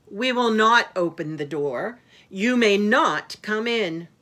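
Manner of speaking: put-on loud, emphatic kids' refusal; firm refusal. A narrator's reading. Brother and Sister Kid bar the wolf, loud firm voice. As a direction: refuse loudly, leaning on NOT.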